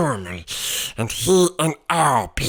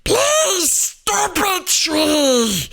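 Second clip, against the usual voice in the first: pleading roar; 3 dB louder, much slower than usual. A narrator's reading. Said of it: "roared Albert".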